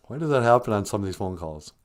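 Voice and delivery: ordinary talk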